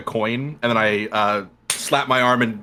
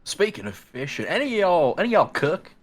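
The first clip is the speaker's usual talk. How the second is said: put-on Southern accent; 8 semitones higher than usual. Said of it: "southern accent".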